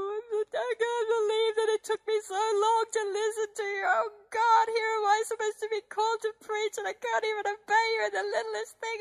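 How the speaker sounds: high, tearful pleading; crying prayer. Retold or imitated. Praying at the altar, high and crying. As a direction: sob out a confession of failure. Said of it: "all I'd do is cry".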